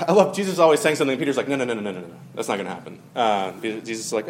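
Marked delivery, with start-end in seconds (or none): 1.5-3.0 low, soft run of brush-off noes; dismissive. Retold or imitated. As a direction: imitate waving away a warning, sinking in pitch and volume. Peter brushing off Jesus' warning.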